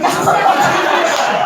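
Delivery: high, loud incredulous shout; mock outrage at his friend; exasperated outburst. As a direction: yell it in disbelief.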